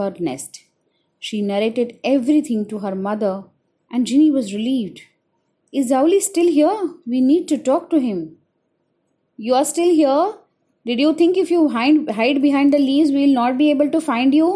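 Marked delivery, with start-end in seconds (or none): 5.8-14.5 accusing. Acted. Birds confront the hiding owl.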